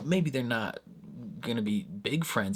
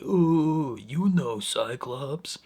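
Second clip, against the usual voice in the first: put-on dim-witted voice, higher and drawn out; dumb voice; 5 semitones higher, 3 dB louder, much slower than usual. Imitating Polyphemus fooling them early in the episode, dumb voice. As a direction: play dumb, slow and raised in pitch. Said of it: "he's kinda putting on a dumb voice".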